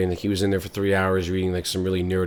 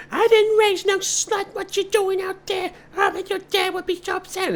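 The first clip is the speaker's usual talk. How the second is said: very high, slow shrieking mother; scolding mom. Acted. Mother berating her pregnant daughter, very high.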